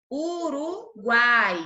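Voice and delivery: Uruguai said very slowly, each vowel stretched out; slow teaching demo. Shown as a demonstration. Teacher showing the class a tritongo, slow stretched demo. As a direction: draw it out so every vowel is heard. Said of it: "O U, o a e o i".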